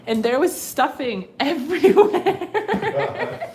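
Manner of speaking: loud, laughing telling; laughing loudly about the stuffing; laughing through story. Real, not acted. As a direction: crack up while telling it. Laughing retelling of dog's mess.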